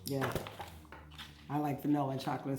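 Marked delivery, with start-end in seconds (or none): none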